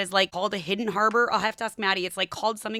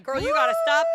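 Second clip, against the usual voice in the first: raised, higher mock call to the kid on the golf cart; calling out to daughter; 6 semitones higher, 5 dB louder than usual. Mom calling to her speeding daughter, raised mock shout. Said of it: "and I was like".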